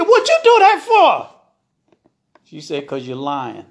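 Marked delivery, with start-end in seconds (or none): 0.0-1.3 high, loud yelp of protest; outraged yell. A narrator's reading. Husband hit again questions his wife, outraged yell. 2.9-3.7 low, softer, unmoved answer; cool accusation. A narrator's reading. Wife explains the blow to her husband, coolly.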